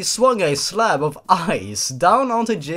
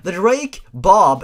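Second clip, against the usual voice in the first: usual speaking voice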